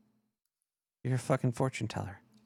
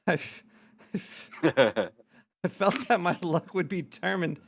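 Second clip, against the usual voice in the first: words broken up by real laughter; slowed down, laughing at the memory; laughing while talking; 3 semitones higher, slower than usual. Real, not acted. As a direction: giggle through the line. Laughing at own fish story.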